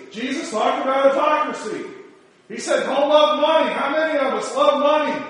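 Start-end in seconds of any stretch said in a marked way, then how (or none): 2.8-5.2 loud high rising preacher cadence; preaching at full force. Acted.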